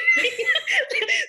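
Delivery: loud high laugh. Real, not acted.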